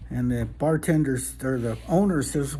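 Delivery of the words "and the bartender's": usual speaking voice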